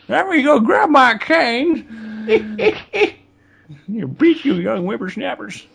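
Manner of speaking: put-on high, cracking old-timer voice; old man voice. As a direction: play a creaky grandpa with a cane. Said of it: "The return of the old man voice".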